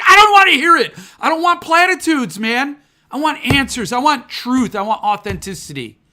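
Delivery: the sulking book snapping at friends cheering it up; ranting book. Pretend. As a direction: demand answers, fed up.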